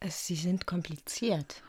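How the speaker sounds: slow, soft whisper; intimate whisper. Acted. Jeanne teases the detective in a slow whisper. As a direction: breathe it close and slow.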